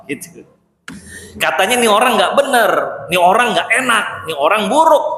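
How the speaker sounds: high, pressing, puzzled questions; Aisyah questioning. Retold or imitated. Aisyah firing questions at the Prophet, high and insistent. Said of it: "diberondong pertanyaan sama Ummul Mukminin Aisyah".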